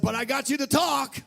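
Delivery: high, loud, sing-song crow of victory; belting the line like a victory cry; triumphant shout. Retold or imitated. Triumphant retold reply.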